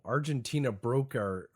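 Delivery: ordinary delivery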